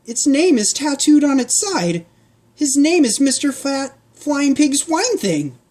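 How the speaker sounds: put-on high girl's voice reading the tattoo; girl Pancake voice. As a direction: point it out in surprise.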